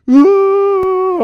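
loud, high, long comic wail; mock wail. Husband losing it during the delivery, mock wail. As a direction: burst into an exaggerated cry. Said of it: "I just started to cry".

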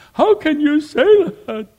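high, loud, slow disbelief; the son, stunned on the phone, shouting in disbelief; shocked son. Retold or imitated. Son's shocked reply on the phone.

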